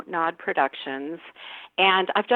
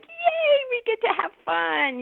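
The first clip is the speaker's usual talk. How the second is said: squealed high yay, then bubbly; mock cheer. Cast on a strict set, high mock cheer of joy. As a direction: cheer like a kid let off the leash. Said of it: "any joy was like".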